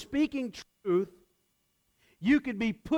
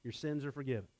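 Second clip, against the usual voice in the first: quieter, lower and tender; soft quote; 5 semitones lower, 9 dB quieter, faster than usual. Acted. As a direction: say it gently to the paralytic. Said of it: "by saying".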